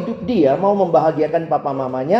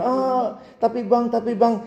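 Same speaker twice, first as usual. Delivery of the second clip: higher, slow whiny buts; mock whining student; 6 semitones higher, slower than usual. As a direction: whine like a stubborn student. (rengekan mahasiswa, lebih tinggi dan lambat)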